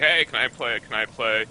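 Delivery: normal talking voice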